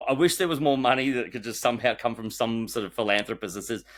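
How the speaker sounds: usual speaking voice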